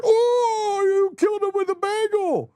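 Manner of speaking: high, exaggerated outcry; mock horror. Host imitating shocked reviewers of a blooper clip, high mock horror. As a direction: squeal it like a scandalized objector. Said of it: "they're like".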